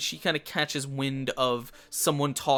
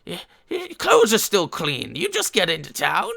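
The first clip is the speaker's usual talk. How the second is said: louder, higher huckster sizing up newcomers; pushy old peddler; 3 semitones higher, 9 dB louder than usual. Acted. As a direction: lean in and hustle the strangers. Old peddler accosts party.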